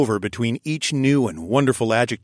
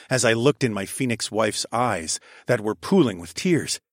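ordinary delivery

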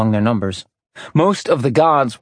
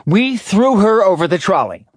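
proud; 8 semitones higher, 4 dB louder than usual. A narrator's reading. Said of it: "Artemis lifted her chin proudly".

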